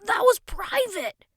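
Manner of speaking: loud, high, slow protest. A narrator's reading. Boy objects to the king hearing his wish, loud protest. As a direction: object indignantly, stretching the words. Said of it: "Russ protested".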